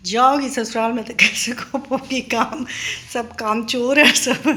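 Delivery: mock motherly scolding. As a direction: imitate a mother's exasperated telling-off. Mother scolding daughters, retold.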